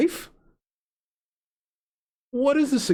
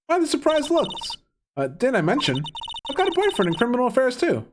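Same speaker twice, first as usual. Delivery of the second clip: lightly voiced character, pitched up; a higher, quicker character voice; game character's teasing voice; 6 semitones higher than usual.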